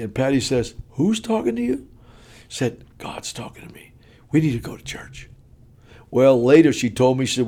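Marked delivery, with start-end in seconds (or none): 0.9-1.9 high, soft imitated wife's query; incredulous question. Wife doubting husband who hears God, high and incredulous. 3.0-5.3 awed, hushed whisper; confiding whisper. Retold or imitated. Husband confiding to wife at night, whispered.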